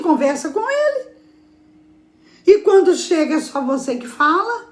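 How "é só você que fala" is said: ordinary talk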